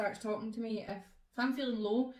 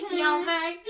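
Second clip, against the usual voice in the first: high, loud laughing Southern drawl; giggly mock Southern accent; 7 semitones higher, 8 dB louder than usual. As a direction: ham up the Southern drawl again. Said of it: "Like Southern drawl".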